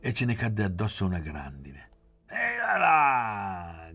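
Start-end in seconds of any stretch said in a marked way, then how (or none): 2.2-3.9 long drawn-out high call, slow and loud; slow high hollered call to the horse; shouting. A narrator's reading.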